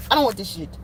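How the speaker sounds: put-on African accent, falling phrases. Rejecting the partner thrown back, in an African accent. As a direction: refuse it in a mock African accent. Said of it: "In my African voice".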